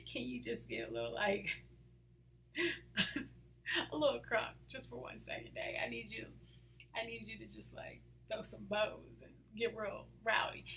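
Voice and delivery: hushed playful plea. Acted. Daughter begging Daddy to get rowdy, hushed and playful. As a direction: plead quietly, with a laugh breaking in. Laughing plea to calm God.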